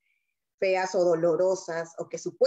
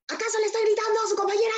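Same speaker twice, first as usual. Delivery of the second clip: imitating the teacher, much higher and fast; mock teacher; 13 semitones higher, much faster than usual. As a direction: rattle it off as the annoyed teacher asking the class. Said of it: "le preguntó a los demás".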